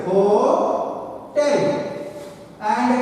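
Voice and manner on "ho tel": "ho-tel" drawn out in two separate syllables; split into syllables. Shown as a demonstration. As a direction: say "ho-tel" with a long gap between "ho" and "tel". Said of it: "it has got two syllables".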